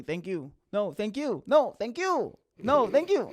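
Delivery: mock escalating thank-you duel; insistent thank-you. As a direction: keep out-thanking them, climbing each time. Acting out escalating polite thank-you exchange.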